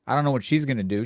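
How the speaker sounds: ordinary delivery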